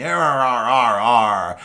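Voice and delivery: gruff pirate arr arr; pirate growls. Pretend. Host playing a pirate on Pirate Day, gruff growl. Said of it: "talk like a pirate".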